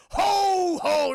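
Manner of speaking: Santa laugh. Retold or imitated. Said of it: "He's like".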